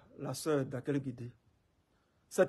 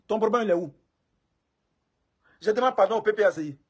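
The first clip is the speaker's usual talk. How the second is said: loud challenge; 4 semitones higher, 10 dB louder than usual. Real, not acted. Challenging the critic loudly.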